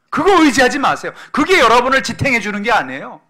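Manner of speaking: loud, high-pitched sermon exhortation; full-force preaching. Real, not acted. Preacher warning congregation not to rely on money, full-force preaching. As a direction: drive each warning home, high and loud.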